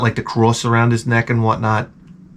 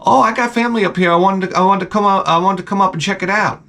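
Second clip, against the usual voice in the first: high, fast, eager mock-kid voice; happy kid imitation; 7 semitones higher, faster than usual. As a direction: gush like an eager young newcomer. Voices the happy kid's answer.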